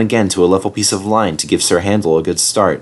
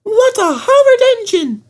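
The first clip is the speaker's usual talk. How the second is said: outraged coaches; 3 dB louder than usual. A narrator's reading. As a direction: squeal it in disgust like coaches.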